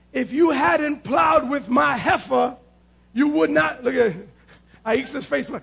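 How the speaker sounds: normal talking voice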